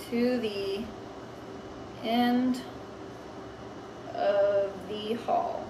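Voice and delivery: each word spoken slowly with long pauses; slow dictation. Shown as a demonstration. Teacher dictating the bubble words slowly as she writes. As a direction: say it slowly while writing it down. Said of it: "slow dictation while writing".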